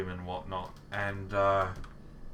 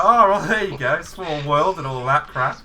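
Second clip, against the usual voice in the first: mock surprise; 7 semitones higher, 12 dB louder than usual. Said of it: "so I was like".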